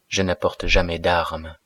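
ordinary delivery

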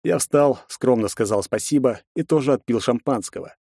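ordinary delivery, nothing marked

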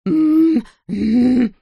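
high, loud muffled hum; gagged moaning. A narrator's reading. Captive Skuperfield signals his rescuers, gagged moaning. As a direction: moan urgently through a gag. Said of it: "снова замычал Скуперфильд".

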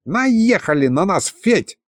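high squeaky dragon head. Acted. Left dragon head squeaking to Fedya. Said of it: "пропищала левая голова".

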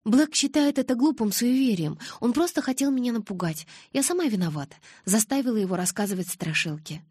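hasty defence. A narrator's reading. Girl defending her friend to Edward, protesting. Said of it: "я бросилась защищать Джейкоба".

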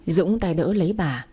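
ordinary delivery

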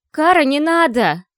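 высокий отчаянный окрик; крикни, чтобы остановить; shouting out. Acted. Maya calls out to stop. (громкий окрик издалека)

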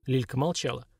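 ordinary delivery

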